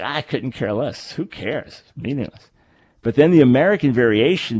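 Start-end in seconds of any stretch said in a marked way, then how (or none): 0.0-2.5 dismissive. Acted. Host acting out someone who doesn't care, dismissive. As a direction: toss it away softly, as if it means nothing. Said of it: "So what do you say?".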